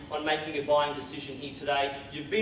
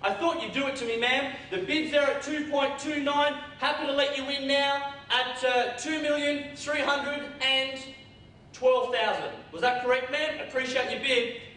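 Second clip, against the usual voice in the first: auctioneer calling; 11 semitones higher, 3 dB louder than usual. Acted.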